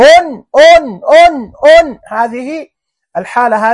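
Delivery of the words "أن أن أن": أن said higher and louder, sounding the hidden nun; nunation sound demo. Shown as a demonstration. Teacher to pupils, sounding the nun higher and louder. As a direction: sing out the an sound. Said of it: "في الحالة الأولى".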